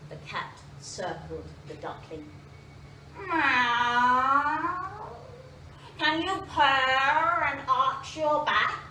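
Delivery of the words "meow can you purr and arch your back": slow, louder purring cat voice; slow cat voice, louder than the narration. Acted. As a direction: drawl it like a haughty cat. Cat questions the duckling.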